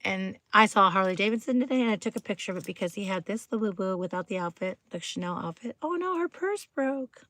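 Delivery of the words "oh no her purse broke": high, soft little wail; small playful distressed voice; mock dismay. Fussing over a doll's broken purse, high mock dismay.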